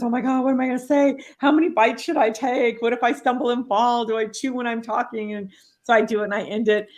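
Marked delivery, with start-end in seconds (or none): 0.0-5.3 hoog en snel, paniekerig gepraat; sneller, hoger en gejaagd, vol zenuwen; nervous. Retold or imitated.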